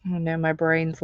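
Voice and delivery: normal talking voice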